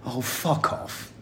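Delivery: slow, breathy, dismissive brush-off. Retold or imitated. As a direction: wave him off, unimpressed. Teammate's dismissive retort, retold.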